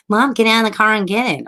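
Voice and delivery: high-pitched retold nagging; imitated impatient kid; insistent son. Son ordering mother, retold.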